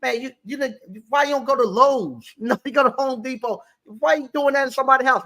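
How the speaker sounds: hammed-up reprimand of a worker; mock angry scolding. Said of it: "he made me so mad".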